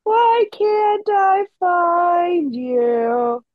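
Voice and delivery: put-on high, slow singsong little girl; drawn-out wistful child voice; Cindy Lou voice. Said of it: "I do love the".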